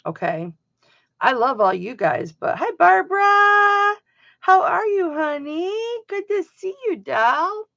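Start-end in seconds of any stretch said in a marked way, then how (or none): 2.6-7.7 sweet greeting. Real, not acted. Greeting a favourite viewer.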